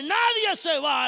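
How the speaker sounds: Paul ordering the prisoners to stay; firm command. Acted. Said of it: "Pablo se pone".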